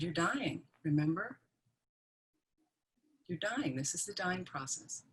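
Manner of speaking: very soft, gentle and steady; calm voice. Retold or imitated. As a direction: tell her the truth softly, no alarm. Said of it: "kind of calm voice".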